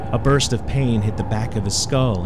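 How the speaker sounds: ordinary delivery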